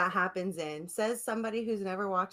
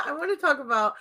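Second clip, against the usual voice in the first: laughing; 6 semitones higher, 5 dB louder, faster than usual. Real, not acted. Laughing while asking.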